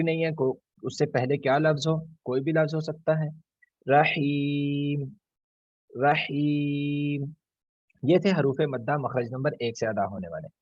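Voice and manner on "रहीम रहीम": "रहीम" very slow, the ee drawn out long. Shown as a demonstration. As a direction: hold the ee in "रहीम" like a chant. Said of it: "या से पहले ज़ेर". ("रहीम" में ई को बहुत लंबा खींचें)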